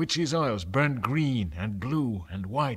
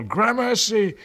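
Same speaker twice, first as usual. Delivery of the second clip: much higher, loud joyful call; 10 semitones higher, 7 dB louder than usual. Acted. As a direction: call out in relieved thanks. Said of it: "they heard me call".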